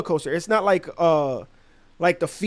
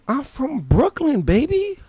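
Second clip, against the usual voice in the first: drawn-out, high-pitched cocky boast; playful swagger; 8 semitones higher, 3 dB quieter, slower than usual. Retold or imitated. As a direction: stretch it out proudly, climbing high on baby. Retold cocky catchphrase answer.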